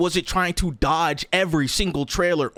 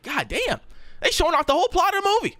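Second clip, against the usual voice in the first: exasperated outburst; 10 semitones higher, faster than usual. Retold or imitated. Mock complaint in movie theater.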